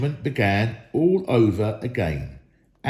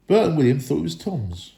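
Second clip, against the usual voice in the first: ordinary delivery, nothing marked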